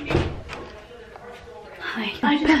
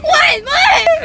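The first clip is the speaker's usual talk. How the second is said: high, loud startled shriek; imitating a panicked yell; retold scream; 10 dB louder than usual. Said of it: "she screams".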